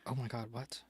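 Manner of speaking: whispered disbelief at the puzzle; a stunned hushed whisper to herself; disbelieving whisper. Real, not acted. Stuck on a crossword clue.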